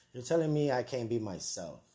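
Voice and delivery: ordinary talk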